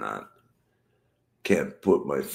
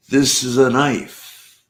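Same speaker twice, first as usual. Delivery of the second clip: a loud, higher mock-Aussie "This is a knife"; Crocodile Dundee quote; 9 semitones higher, 11 dB louder, slower than usual. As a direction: ham up the movie line. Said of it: "My favorite line".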